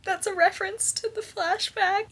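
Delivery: a light mock gasp of recognition; excited realization. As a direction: squeal the discovery. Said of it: "you're like".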